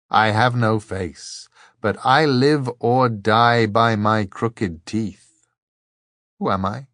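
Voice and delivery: measured, slow recitation with lifted line openings; reciting a riddle. A narrator's reading. Second monk poses his riddle to three travellers, reciting. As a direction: intone it like a ritual challenge. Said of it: "and then recited".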